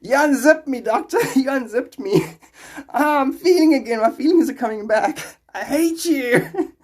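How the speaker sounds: high, loud, overwrought patient voice; quoted patient. Retold or imitated. Quoting the TV patient to chat, melodramatic. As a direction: ham up the patient's outburst. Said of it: "he's like".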